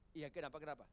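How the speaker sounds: usual speaking voice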